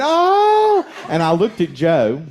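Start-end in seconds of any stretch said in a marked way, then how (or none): 0.0-0.9 sudden high, loud surprised "Oh!". Retold or imitated. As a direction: yelp it as the penny drops. Wife realizes the language switched.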